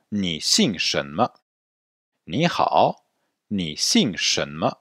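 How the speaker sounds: slow Mandarin question; answer model. Shown as a demonstration. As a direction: say "Ni Xing Shen Me" slowly. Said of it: "on va maintenant corriger". (modèle lent en mandarin)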